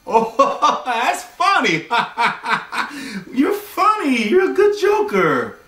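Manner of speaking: high, hammed-up laughing; mock amusement. Date laughing off her goodnight kiss request, mock amusement. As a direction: imitate a man cracking up at a joke.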